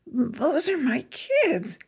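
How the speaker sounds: slow, high, scratchy gasp. A narrator's reading. Miss Nelson spots her truant kids, in a slow scratchy voice. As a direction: rasp it out in disbelief. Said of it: "she said in a scratchy voice".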